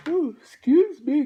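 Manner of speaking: high, loud yawn stretched over the words. Real, not acted. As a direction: yawn through the apology. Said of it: "that lengthy, long, lengthy yawn".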